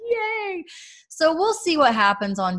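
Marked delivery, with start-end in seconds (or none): 0.1-0.6 little cheer. Retold or imitated. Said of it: "I was like".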